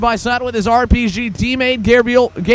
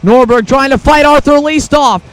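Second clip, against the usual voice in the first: motorsport commentator; 4 semitones higher than usual. Real, not acted. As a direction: build up as the karts go three wide. Three wide battle for second.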